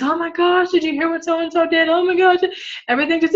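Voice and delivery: high, breathless gossip voice; gossipy friend. Retold or imitated. Friend full of drama, gushing gossip high and eager. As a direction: gush like a drama-loving friend. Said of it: "in that tone of voice".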